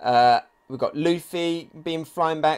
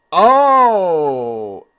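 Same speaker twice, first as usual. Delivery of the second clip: loud surprised oh; 9 dB louder than usual. Real, not acted. Sudden reaction to Kaido panel.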